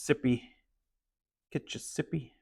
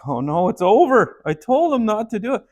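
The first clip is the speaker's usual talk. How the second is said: despairing coach; 8 semitones higher, 7 dB louder than usual. Retold or imitated. He's like.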